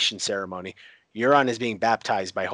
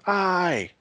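high cheering shout of the crowd; 10 semitones higher than usual. Retold or imitated. Crowd roars agreement to Yara, high shout.

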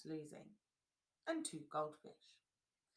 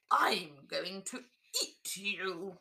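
slow, loud monster growl; roaring dinosaur; 8 dB louder, slower than usual. Acted. Dinosaur roaring at the paper dolls, slow and loud. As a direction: roar it out like a hungry dinosaur. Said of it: "clawed and roared and said".

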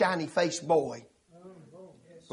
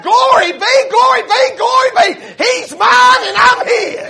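high, loud shout, slowing at the end; jubilant praise shout; 11 dB louder, slower than usual. Retold or imitated. Preacher acts out converted Mama praising, jubilant shout. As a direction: shout praise and stretch the last phrase.

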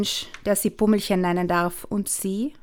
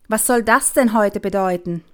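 louder, reproachful outburst; reproachful father; 6 dB louder than usual. Acted. Father scolding daughter for kicking, raised reproachful voice. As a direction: scold her sharply, voice raised. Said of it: "Vorwurfsvoll schaut er Lola an".

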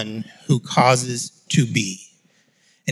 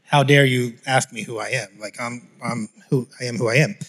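dismissive; 3 semitones lower than usual. Pretend. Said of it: "it can almost even feel dismissive".

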